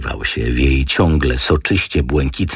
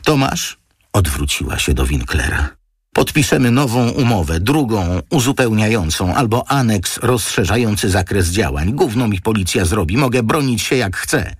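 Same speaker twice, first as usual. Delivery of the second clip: higher, defiant woman's voice; Antonina, defiant; 8 semitones higher than usual. A narrator's reading. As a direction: she brushes off the police.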